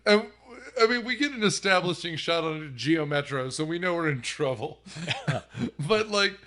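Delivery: ordinary delivery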